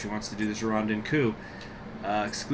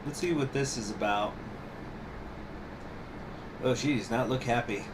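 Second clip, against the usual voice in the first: normal talking voice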